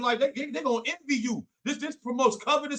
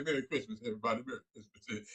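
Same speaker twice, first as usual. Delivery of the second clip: softer, quick mock holiday greeting; lightly mocking a hypocrite's cheer; mock cheerful greeting; 11 semitones lower, 11 dB quieter, faster than usual. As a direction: toss off a fake Merry Christmas. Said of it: "be like".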